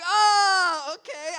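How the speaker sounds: loud, high, drawn-out "oh"; alarmed reaction. Retold or imitated. Teenager stuck in the back of a smoky car, alarmed. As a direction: cry out in dismay, then drop back.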